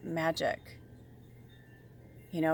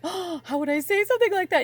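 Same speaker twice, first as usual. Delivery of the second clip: high, loud, shocked voice; put-on scandalized squeal; mock shocked self; 10 semitones higher, 5 dB louder than usual. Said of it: "where I'm like".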